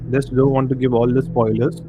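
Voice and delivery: ordinary talk, nothing marked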